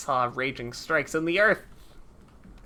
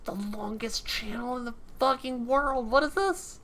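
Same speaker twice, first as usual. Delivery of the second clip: high-pitched, incredulous complaint; exasperated; 6 semitones higher, 5 dB quieter than usual. Real, not acted. Exasperated at long cast bar.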